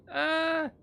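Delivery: sudden high yelp as she drops; startled yelp. Real, not acted. Player yelping while jumping off a ledge, high startled cry. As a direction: yelp in real fright, high and loud.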